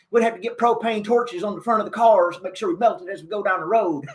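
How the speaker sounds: put-on folksy Texan president talking to the kids; George W. Bush impression. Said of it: "impersonation of George W. Bush".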